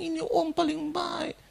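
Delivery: high, drawn-out mock weeping; retold sobbing lament, pitched high; tearful, imitated. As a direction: imitate a mourner wailing slowly through tears. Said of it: "Dia ucapkan itu berulang-ulang".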